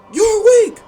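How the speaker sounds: high, loud angry shout; explosive, high-pitched angry yell; Loki, angry. Acted. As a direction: yell it with scornful fury. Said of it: "as Loki angrily says".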